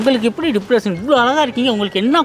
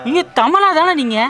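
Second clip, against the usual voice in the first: excited fan; 6 semitones higher, 5 dB louder than usual. Acted. Excited fan asking actress.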